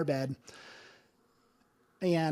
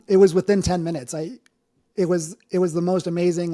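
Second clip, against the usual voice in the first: louder, choked up with real emotion; getting emotional; 7 dB louder than usual. Real, not acted. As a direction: push through the rising emotion. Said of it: "I'm gonna get emotional".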